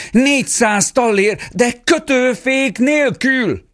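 high, slow, desperate shout after the rider; desperate call. A narrator's reading. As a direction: cry out the forgotten condition, too late. Father desperately calls after knight.

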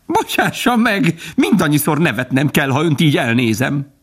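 very high-pitched, amused woodpecker; woodpecker calling down. A narrator's reading. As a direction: call down in a high, mocking bird voice. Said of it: "lekiáltott hozzájuk". (magas hangú, lekiáltó harkály)

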